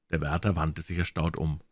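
ordinary delivery, nothing marked

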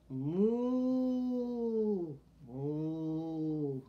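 low drawn-out moo; mooing cow. Shown as a demonstration. Showing children the cow's sound, a gentle moo.

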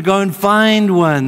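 loud, high, drawn-out exhortation; emphatic urging. Real, not acted. Preacher urging listeners who want a friend, emphatic. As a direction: urge it loudly, stretching it out.

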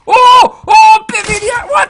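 loud, high, quick shout; startled yelp. Real, not acted. Player bitten by the dog in game, startled yelp.

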